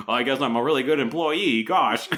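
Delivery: high, loud mock aw-shucks voice; hammed-up gee-whiz innocence; oblivious modesty. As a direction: play a clueless employee lapping up praise. Acting oblivious Bud answering his boss.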